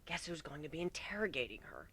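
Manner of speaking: hushed, hissed aside. Acted. Kuno hissing to Warren, soft and sly. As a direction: hiss it to him confidentially. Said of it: "hissing in an explanation".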